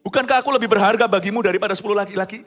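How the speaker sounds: loud, high, impassioned verse reading; scripture reading. Acted. Preacher reads a husband's plea to the congregation, loud scripture reading. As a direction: read the verse out at full force.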